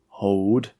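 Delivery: firm voiced command; commander's order to hold fire. A narrator's reading. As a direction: give a short order with authority. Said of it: "he ordered".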